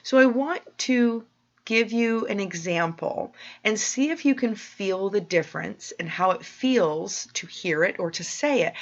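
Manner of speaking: usual speaking voice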